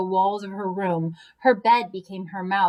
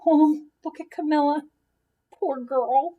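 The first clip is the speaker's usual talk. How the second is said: pitying aside; 5 semitones higher than usual. Acted. Narrator pitying the sick girl, higher voice. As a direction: feel sorry for the poor girl.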